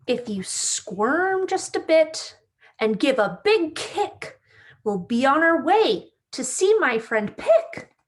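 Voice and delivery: bright, lively storybook read-aloud; reading to children. A narrator's reading. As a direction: read it like story time for little kids. Reads picture book to children.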